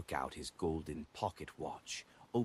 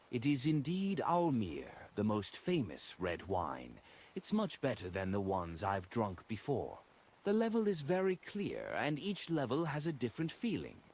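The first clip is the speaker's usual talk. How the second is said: higher, put-on voice of a languid woman; a wine lover's higher, lingering praise; Force, savouring wine; 6 semitones higher than usual. A narrator's reading. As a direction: relish each word like a wine snob.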